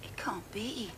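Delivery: barely voiced, stunned; shocked whisper. To Svidrigailov, a stunned whispered denial. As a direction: breathe it out in disbelief.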